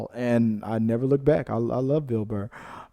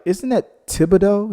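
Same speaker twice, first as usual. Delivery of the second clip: higher, a bit louder question; lightly voiced Hank, pitched up in disbelief; puzzled Hank; 8 semitones higher, 4 dB louder than usual. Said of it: "And then Hank was like".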